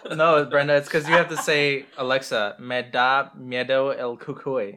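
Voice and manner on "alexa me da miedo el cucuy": slow Spanish in a gringo American accent. Shown as a demonstration. Showing how Alexa needs Spanish in an American accent, slowly. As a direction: spell out "me da miedo el cucuy" slowly with an American accent. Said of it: "say it in a broken English".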